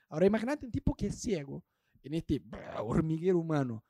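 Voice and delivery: usual speaking voice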